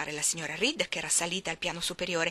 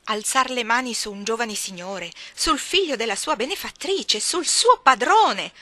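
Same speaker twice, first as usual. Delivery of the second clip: scandalized scolding; 3 semitones higher, 8 dB louder than usual. Acted. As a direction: drag out the final rebuke. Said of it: "esclamò la cameriera". (rimprovero indignato, forte, rallentando alla fine)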